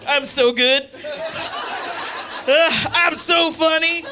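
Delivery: mocking smug dad. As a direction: sneer it like a self-satisfied dad.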